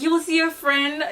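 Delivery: ordinary talk, nothing marked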